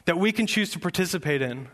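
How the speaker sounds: loud, high, rousing sermon delivery; full-force preaching. Acted. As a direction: preach it out, building with fire. Pastor preaching to congregation.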